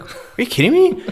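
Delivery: high-pitched, incredulous outburst; squeaky-high indignant disbelief. Retold or imitated. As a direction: react in retold disbelief at an outrageous fine. Retells own disbelief at the fine.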